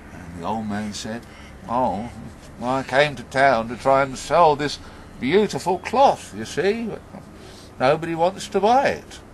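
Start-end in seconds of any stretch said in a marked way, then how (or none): 1.7-9.2 lightly voiced old man, higher in pitch; disappointed old man. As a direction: confide the failed errand to a stranger. Old man explains his trouble.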